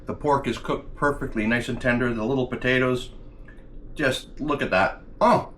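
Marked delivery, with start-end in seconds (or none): 5.2-5.5 surprised. Real, not acted. Sudden exclaim while tasting.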